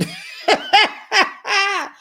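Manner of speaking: a real shrieking laugh; loud high laugh. Real, not acted. Laughing at own retold question.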